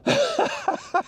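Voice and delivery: a quick, very high laugh; high giggle. Real, not acted. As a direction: laugh at the wet lens. Water all over the lens.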